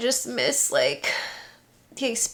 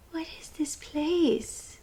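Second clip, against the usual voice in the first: slow whispered wonder; whisper to cat; 5 semitones higher, 7 dB quieter, slower than usual. Real, not acted. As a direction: whisper as if seeing it through her eyes. Voicing cat's curiosity.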